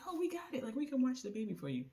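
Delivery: high, fast eager insisting; eager reassurance. Retold or imitated. Husband assures couple they'll babysit, high and eager. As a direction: jump in too keen to help.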